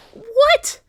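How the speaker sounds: high, loud burst of shocked questions; stunned rapid questions. Acted. Maya hearing her friend was arrested, loud and shocked. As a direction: fire off each question in disbelief.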